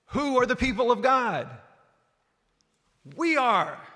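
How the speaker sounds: loud, high rallying call; rally the whole church; preaching climax. Acted. Preacher rallying the congregation with a loud call.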